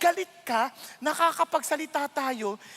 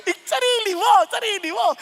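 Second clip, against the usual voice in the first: laughing, higher and louder; 8 semitones higher, 7 dB louder than usual. Real, not acted. Pastor laughing while correcting the congregation, laughing.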